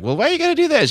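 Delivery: high put-on wife voice. Host imitates his wife's complaint in a high whiny voice. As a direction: whine it like the nagging wife. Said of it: "Why you gotta do her voice like that?".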